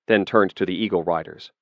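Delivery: plain reading voice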